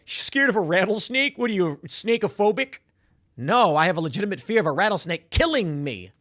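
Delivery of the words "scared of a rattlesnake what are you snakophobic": higher, put-on taunting voice; mocking accuser. Imagined heckler taunting rattlesnake avoider, mocking. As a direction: jeer it as a silly accuser.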